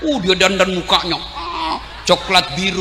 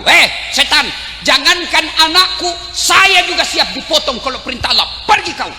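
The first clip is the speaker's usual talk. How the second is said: defiant shout; 6 semitones higher than usual. Acted. Hajar rebukes the devil and sends him off, defiant shout. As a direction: stand firm and throw it at him.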